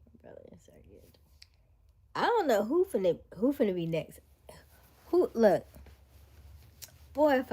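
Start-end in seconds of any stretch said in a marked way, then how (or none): 0.0-1.2 soft whisper. Real, not acted. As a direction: whisper it low. Whispered aside while eating.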